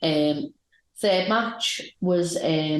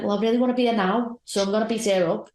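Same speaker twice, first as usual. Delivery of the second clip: put-on higher, fast childish threat; higher, fast childlike threat; higher whiny threat; 6 semitones higher, faster than usual. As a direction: whine it, then threaten playfully. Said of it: "She's like".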